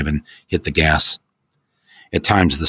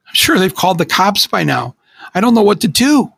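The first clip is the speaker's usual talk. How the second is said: high, tearful and pleading; a scared teen falling apart; breaking down; 13 semitones higher, 3 dB louder than usual. Acted. Teen on the phone to his friend, high and tearful. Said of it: "He broke down uncontrollably".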